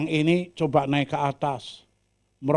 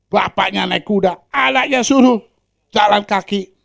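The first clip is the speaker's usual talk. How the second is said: high, loud scolding onlooker; tut-tut at the lazy father; loud, higher outraged bystander; indignant gossip; 7 semitones higher, 10 dB louder than usual. Acted.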